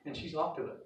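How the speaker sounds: ordinary talk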